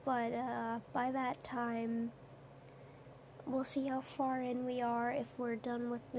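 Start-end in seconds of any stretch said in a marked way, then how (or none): none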